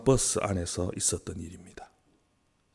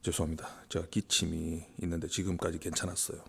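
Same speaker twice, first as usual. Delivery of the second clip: hushed apology; 5 semitones lower, 17 dB quieter than usual. Real, not acted. Apologizes after coughing fit.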